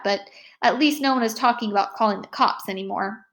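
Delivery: plain reading voice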